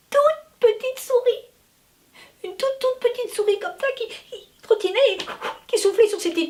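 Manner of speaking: tiny voice. A narrator's reading. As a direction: make the mouse sound tiny. Describing the tiny mouse.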